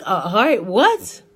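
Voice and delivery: high, loud, incredulous question; retold astonishment, pitch leaping up; shocked disbelief. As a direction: blurt it out in mock shock. Shocked at needing heart monitor.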